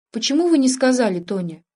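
ordinary delivery

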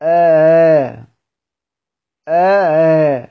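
آ held between a hamza and an alif; a drawn-out آ with a softened glottal onset; eased hamza. Shown as a demonstration. Teacher isolates the sound for students, eased hamza on آ. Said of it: "أجعلها بين الهمزة والألف".

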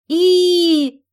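very high, loud heave cry; loud high heave as they pull; effort heave. Acted. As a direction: heave on the door together. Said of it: "громко изрекли все три подруги".

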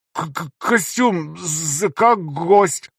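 high, stammering robotic voice; distorted robotic stutter. A narrator's reading. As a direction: stutter through a distorted mask. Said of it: "искажённым голосом, напоминающим роботизированный".

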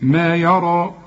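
يرى ending in a dark held "raw"; light ya, thick long ending in يرى; heavy final alif. Shown as a demonstration. Teacher demonstrates the rule, يرى ending heavy. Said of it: "ألف مفخمة برضو".

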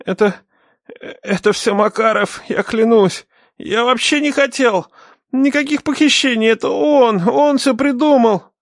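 high-pitched panicky denial; nervous pleading. A narrator's reading. Cornered captive blames his accomplice, nervous and high. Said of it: "мужчина стал нервничать".

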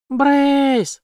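high, drawn-out call; cry for help. A narrator's reading. Jane cries out for Brace, high shout. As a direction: call out the name in alarm. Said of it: "закричала она".

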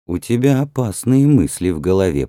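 ordinary delivery

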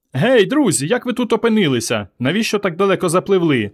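put-on high, girlish hail; calling out. A narrator's reading. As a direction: call across to swimmers in a young girl's high voice. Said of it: "молоденька дівчина та закричала".

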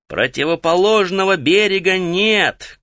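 high, projected shouted reply; shouting back. A narrator's reading. Каллулу answers Таита from far below, shouting back. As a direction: call it back up, pitched well above normal. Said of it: "крикнул в ответ Каллулу".

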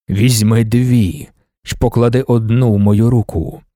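low, slow, solemn ruler addressing the crowd; God Emperor's voice. A narrator's reading. Emperor presents Duncan to the worshipping women, low and solemn. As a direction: speak as an ancient emperor presenting his guard.

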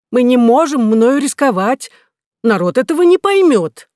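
loud, high indignant objection; indignant protest. Acted. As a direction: protest it, raising the voice. Minister refuses, indignant.

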